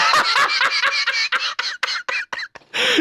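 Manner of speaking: laughing hard and high; a long burst of laughter. Real, not acted. Laughing at funny video.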